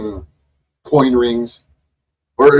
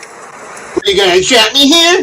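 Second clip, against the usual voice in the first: high-pitched mock of an upset bystander; mocking echo; 9 semitones higher than usual. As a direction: parrot the complaint in a high, put-on voice. Mockingly echoes woman in clip.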